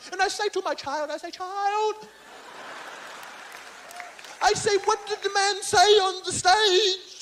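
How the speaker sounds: high, stretched interrogating father; Bill Cosby. Acted. Father questioning his child about the show, ponderous impersonation. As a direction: draw out the stern question in a mock-fatherly voice. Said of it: "Bill Cosby just called me up".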